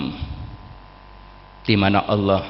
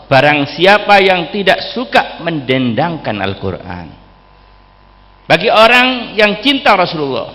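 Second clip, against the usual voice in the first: higher, louder impassioned sermon delivery; fervent preaching; 8 semitones higher, 8 dB louder than usual. Acted. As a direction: preach it out to the crowd with fire. Preacher rising to fervent exhortation.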